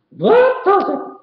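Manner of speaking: a tiny hero declaring he's had enough; squeaky cartoon mouse full of resolve; Mickey's voice. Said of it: "said Mickey".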